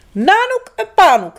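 high, loud stern call of the names; angry parents calling. Acted. Angry polar parents summon their children, stern call. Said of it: "waren ze heel erg boos".

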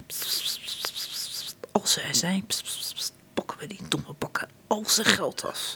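hushed unvoiced scheming whisper. A narrator's reading. Prince John whispering his plan to Sir Hiss. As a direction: breathe it like a secret plot. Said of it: "fluistert tegen graaf Slis".

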